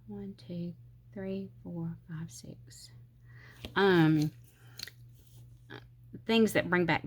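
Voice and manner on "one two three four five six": whispered count to oneself; very soft, mostly unvoiced-sounding numbers; counting under breath. Real, not acted. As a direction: murmur the numbers as you measure. Counting to herself while measuring.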